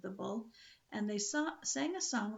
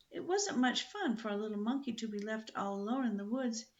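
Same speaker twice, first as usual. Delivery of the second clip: plain reading voice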